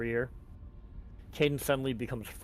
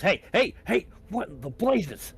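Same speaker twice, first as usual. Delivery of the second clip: high, loud startled outburst; startled surprise; 8 semitones higher, 6 dB louder than usual. Acted. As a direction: burst out in alarmed surprise. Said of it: "nearly falls over in surprise".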